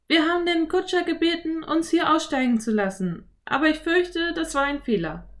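put-on high girl voice, smiling; a smiling little girl answering; Anne, smiling. A narrator's reading. Anne explains. (Mädchenstimme, lächelnd)

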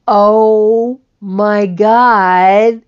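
high, loud and slow, delighted; joyful slow mock surprise; amazed echo. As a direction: stretch the words in disbelief. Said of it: "And he goes".

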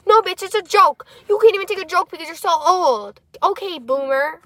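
high, loud mock yelling at mom; mock outraged retort. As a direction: shout back in playful exasperation. Mock retort to mom, then kidding.

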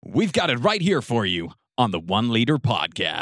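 loud American announcer; punchy American podcast intro; American accent. Shown as a demonstration. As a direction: sell it in a big American accent. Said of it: "I could do the American accent".

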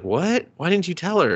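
high, incredulous imitation; mock disbelief. Nephew questioning uncle about the barrier, mock incredulous. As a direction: pitch it up in retold shock.

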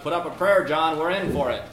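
high, loud cry for help; terrified shout. Retold or imitated. Terrified man to his companion as a bull charges, shouting. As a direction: yell it in mock panic. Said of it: "Terrified one shouted".

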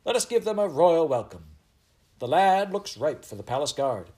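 high, loud royal proclamation. A narrator's reading. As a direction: address a whole crowd as a commanding queen. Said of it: "She raised her voice and addressed the crowd".

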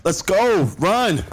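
urgent shouts on the run; shouting while running. Acted. Running to catch the flight.

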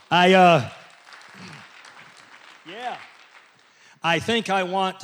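usual speaking voice

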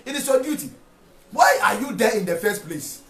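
normal talking voice

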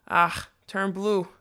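slow, embarrassed grumble; mumbled retort. A narrator's reading. Flustered magician to the jeering lock, mumbled. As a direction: drag it out under a blush. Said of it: "the magician mumbled".

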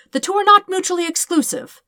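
high, loud protest; indignant insistence. Acted. Dirk answering Todd's grenade remark, indignant. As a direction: insist he has a point, flustered.

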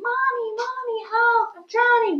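high mock child cry for help; children crying for help. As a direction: squeal like drowning kids, hammed-up. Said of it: "when they were crying".

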